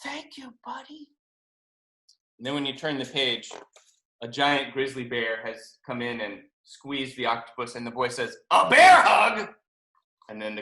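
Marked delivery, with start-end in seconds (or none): 0.0-1.1 high, very soft, slow thanks; hushed, surprised little voice; surprised, touched. A narrator's reading. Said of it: "the octopus is so surprised". 8.5-9.5 loud, higher, drawn-out reveal; loud, playful surprise reveal; gleeful announcement. A narrator's reading. Boy springs bear hug surprise.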